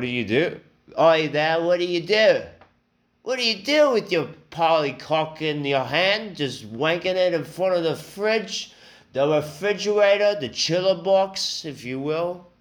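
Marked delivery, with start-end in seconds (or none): 1.0-2.4 high, loud mock British grilling; hammed-up British salesman, pitched way up; British accent. Salesman grilling his customer, in mock British accent. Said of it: "the guy I had was British". 3.2-12.5 high-pitched mock British salesman; British accent. Salesman needling a customer who has no TV, in mock British accent. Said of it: "the guy I had was British".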